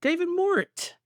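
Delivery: high-pitched name read; high silly voice. Acted. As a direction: lift it way up high. Silly patron voice.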